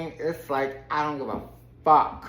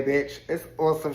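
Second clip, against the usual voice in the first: ordinary delivery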